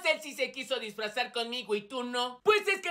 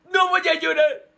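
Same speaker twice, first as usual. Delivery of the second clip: losing the fight against tears; 7 dB louder than usual. Acted. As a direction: break into mock crying while insisting. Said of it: "Yo ya estoy llorando". (rompiendo en llanto fingido, más agudo)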